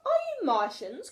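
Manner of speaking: called out high and loud; calling out. A narrator's reading. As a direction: call across to them, eager. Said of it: "called George".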